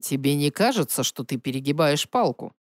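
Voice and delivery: low, oily charm of an ex. Acted. Emil's voice, low.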